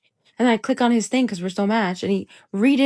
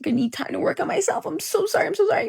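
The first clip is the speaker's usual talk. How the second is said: mock guy voice, higher, softer, fake sorry; mock apologetic guy; 9 semitones higher, 5 dB quieter than usual. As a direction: imitate a guy faking remorse, pitched up. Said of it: "they're like".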